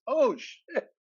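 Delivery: high-pitched retold astonishment; stunned realization. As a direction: act out a friend's sudden shock. Carlos's retold shock at the match.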